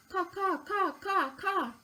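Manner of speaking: high, harsh caw of a crow; imitating a crow for a child. Shown as a demonstration. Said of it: "Let's try it together".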